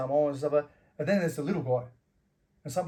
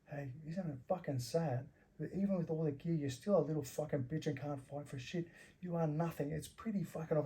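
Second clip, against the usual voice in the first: hushed, menacing put-down; close in the ear; 11 dB quieter than usual. Retold or imitated. Bouncer into the beaten man's ear, hushed. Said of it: "got really close to his ear".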